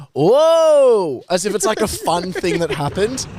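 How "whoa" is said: loud, high mock whoa of a bystander. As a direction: imitate a thrilled onlooker, loud and up high. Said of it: "Someone goes".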